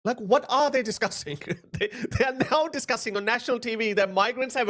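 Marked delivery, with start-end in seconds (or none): none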